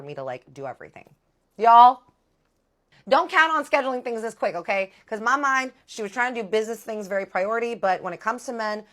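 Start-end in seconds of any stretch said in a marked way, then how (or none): 1.6-2.0 calls out loudly to the viewers. Real, not acted. Loud aside to viewers. 3.1-8.9 higher, louder, urgent advice to viewers; excited warning. Real, not acted. Warning viewers, high and loud.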